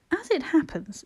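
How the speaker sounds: exasperated triumph. A narrator's reading. Girl proving the others wrong about the feathers, exasperated triumph. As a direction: score a point, exasperated. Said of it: "in a tone of exasperated triumph".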